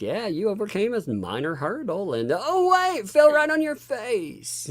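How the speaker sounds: mock commentator. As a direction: build from calm to shocked, slowing at the end. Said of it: "It was like".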